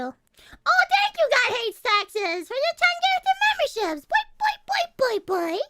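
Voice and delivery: very high, loud squeaky thanks; excited thanks. Real, not acted. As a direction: squeal with delight at the gift.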